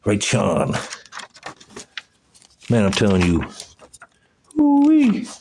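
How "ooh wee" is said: a high, ringing ooh-wee of amazement; amazed whoop. Real, not acted. Staring at old photos, whooping in real amazement. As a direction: whoop like you can't believe your eyes.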